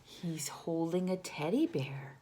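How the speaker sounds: plain reading voice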